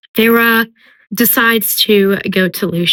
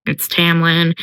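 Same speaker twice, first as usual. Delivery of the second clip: plain reading voice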